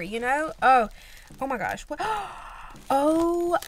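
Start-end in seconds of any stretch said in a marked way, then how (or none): 2.0-2.8 a sharp, quiet intake of breath; surprised gasp. Real, not acted. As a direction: gasp as the gift is revealed. Surprise opening package.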